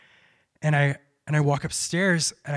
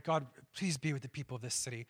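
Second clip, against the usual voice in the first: softly praying to God; quiet prayer; 3 semitones lower, 9 dB quieter than usual. Retold or imitated. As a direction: pray it low, from the heart. Praying on the hotel roof.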